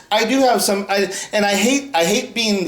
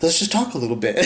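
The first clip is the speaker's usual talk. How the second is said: slowing down; 2 semitones lower, 4 dB quieter than usual. Real, not acted. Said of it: "slow it down".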